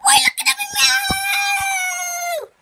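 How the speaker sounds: high, loud call to show off the new Crocs; excited shout. Pretend. Cat toy calling to friends to look at its Crocs, loud and high. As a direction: shout it out so they have to look.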